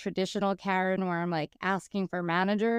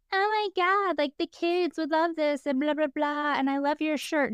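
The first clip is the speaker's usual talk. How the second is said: much higher, gushing mock chatter; 6 semitones higher than usual. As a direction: parody the women gabbing through the show. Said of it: "and just screaming".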